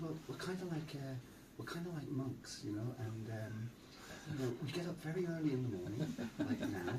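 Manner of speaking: softly spoken, careful politeness; super polite. Retold or imitated. Monk explaining early rising to police, super polite. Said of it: "You start to be this super polite person".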